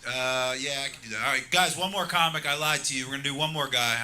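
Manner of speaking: ordinary delivery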